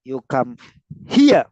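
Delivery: pause, then hit "here"; stress on HERE. Shown as a demonstration. Teacher showing how to highlight a word, HERE punched. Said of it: "stress that word".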